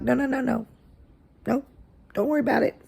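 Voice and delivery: high, soft sing-song no; sing-song reassurance. Retold or imitated. Teacher sweetly refusing the begging boy, high soft voice. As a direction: sweetly brush off his pleading.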